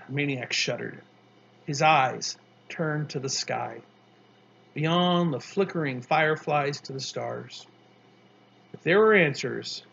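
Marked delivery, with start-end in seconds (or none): none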